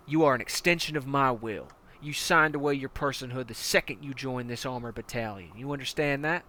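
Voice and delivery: hard-nosed commander barking orders at a recruit; menacing armored battalion commander; tank commander. Acted. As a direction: lean in like a brutal drill sergeant.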